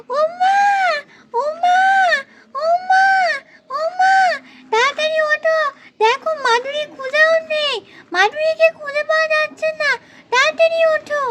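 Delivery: high, tearful cries for mum; panicked calling. Acted.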